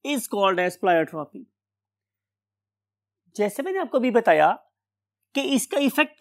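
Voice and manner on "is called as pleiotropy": word-by-word dictation with long pauses; slow dictation. Real, not acted. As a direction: dictate slowly as if writing it on the board. Dictates a definition while writing.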